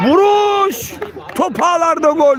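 screaming football commentator. Real, not acted. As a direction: yell the goal call at full pitch. Goal scored in live commentary.

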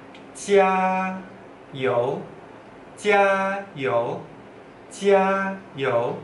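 加油 very slowly, long high level 加, pause, rising 油; slow tone drill. Shown as a demonstration. Teacher asking learners to try slowly, 加油 drawn out tone by tone. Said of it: "Coba ngomong pelan-pelan".

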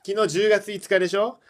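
usual speaking voice

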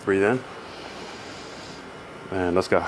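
low, soft coaching voice for breathing; low soothing stretch coach; calm breathing cues. Acted. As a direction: guide the client through each breath, softly.

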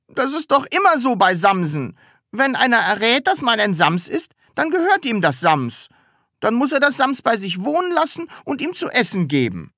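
put-on high, plaintive childlike whine; close to tears. A narrator's reading. Sams pleading with Taschenbier to keep it, tearful. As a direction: plead as if about to cry. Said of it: "als ob es gleich weinen würde".

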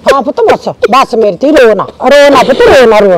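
loud, lowish pleading to stop crying; urgent consoling. Acted. Mother to her crying daughter, urgent consoling. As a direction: hush a weeping daughter with force.

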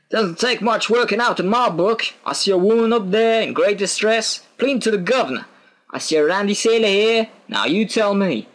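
high-pitched guard voice; blunt guard. Acted. Guard telling Jack why he is arrested, high and blunt.

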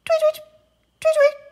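very high, loud tweeting; high loud bird tweets; starling tweets. Acted. Starlings chitter.

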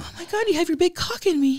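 high, amazed falsetto; flustered high put-on voice; Greg. Character in comic love scene, high and amazed.

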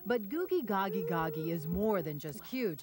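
steady anchor delivery; news read. Acted.

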